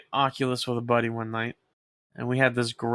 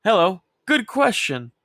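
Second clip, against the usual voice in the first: mock polite; 6 semitones higher, 4 dB louder than usual. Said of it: "they're like".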